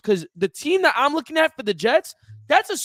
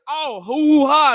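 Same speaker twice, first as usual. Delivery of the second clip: mock boasting; 3 semitones higher, 5 dB louder, much slower than usual. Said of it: "Y'all come in and it's like".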